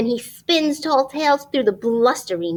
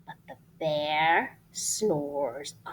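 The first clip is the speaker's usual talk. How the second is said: whisper; 8 semitones lower, 6 dB quieter, slower than usual. Acted. Refrain whispered over sleeping bear.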